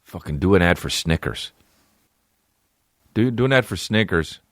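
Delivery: normal talking voice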